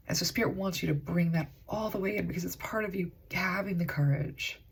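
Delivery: ordinary delivery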